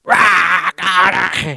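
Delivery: loud, drawn-out roar; long mock war cry; raging roar. Imitates people raging at God.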